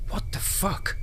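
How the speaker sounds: shocked whisper. Acted. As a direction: breathe it out in disbelief. Hushed shock at gunpoint.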